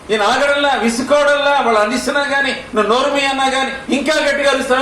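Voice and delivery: high, fervent preaching. Acted. Preacher describing the blind man's persistence, high fervent preaching. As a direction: preach with rising fervor.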